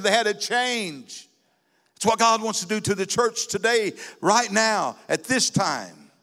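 high-pitched, ringing sermon delivery; impassioned preaching. Real, not acted. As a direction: preach it with rising fervor, stretching key words. Preaches at full force.

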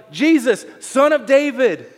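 high, loud, drawn-out plea; imitated desperate yell to Jesus. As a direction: call out for mercy, slow and high. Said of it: "and he's yelling".

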